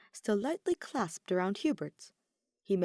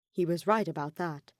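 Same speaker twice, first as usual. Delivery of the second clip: ordinary delivery, nothing marked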